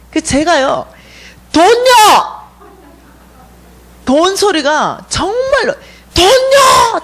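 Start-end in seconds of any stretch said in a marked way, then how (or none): 1.5-2.4 high, loud retold shout; heart's cry. She answers the dream visitors from inside, loud cry. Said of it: "내 심장 안에서". 6.1-7.0 very high, loud drawn-out shout; pleading cry. Retold or imitated. She begs the dream visitors for money, high shout. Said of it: "제가 이렇게 구하는 거예요".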